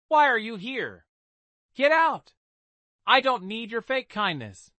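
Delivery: high, loud angry shout at her sister. Acted. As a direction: throw her out at the top of her voice. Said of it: "Clara Bennet shouted loudly".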